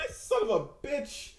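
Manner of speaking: mock outrage dissolving into laughs; laughing curse. Real, not acted. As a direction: curse slowly through laughter.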